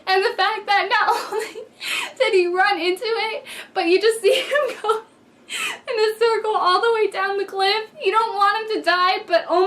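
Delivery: can't get through it without cracking up; laughing. Real, not acted. Reviewer describing Kurt's fall, laughing throughout. Laughing while retelling death scene.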